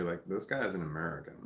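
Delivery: ordinary talk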